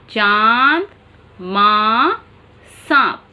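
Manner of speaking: carefully nasalize चांद, माँ, सांप; nasalized vowel demo. Shown as a demonstration. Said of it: "देखिए".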